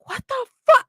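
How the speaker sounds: high, startled what the fuck; stunned exclamation. Retold or imitated. Walking out of church into heat, startled. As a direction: hit by the heat, blurt it out. Retold shock stepping outside.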